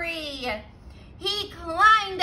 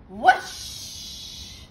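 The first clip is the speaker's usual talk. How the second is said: whoosh; 6 semitones lower than usual. A narrator's reading. House blown away.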